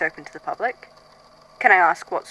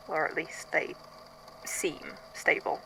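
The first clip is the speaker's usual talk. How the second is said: fading whisper; 2 semitones lower, 12 dB quieter than usual. Acted. Uneasy doubt creeping in.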